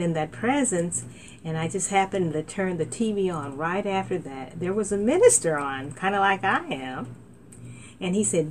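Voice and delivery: usual speaking voice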